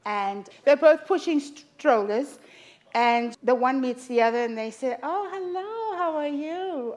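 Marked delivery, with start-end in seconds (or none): 5.0-6.9 high, gushing Yiddish-mama greeting; singsong Yiddish mother saying hello. Acted. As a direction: greet a stranger in a high-pitched Yiddish lilt. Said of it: "these two Yiddish mamas".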